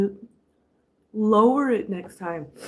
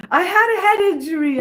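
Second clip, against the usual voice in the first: high loud laughing joke; 7 semitones higher, 5 dB louder, faster than usual. Real, not acted. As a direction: laugh it out fast. Laughing at own confusion.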